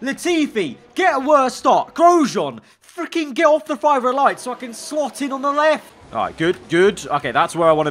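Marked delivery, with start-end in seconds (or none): 0.0-5.9 high-pitched real grumbling at rivals; annoyed. Real, not acted. Gamer to rivals at the starting lights, annoyed. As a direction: scold them in a raised pitch without shouting. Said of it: "I'm getting so annoyed now".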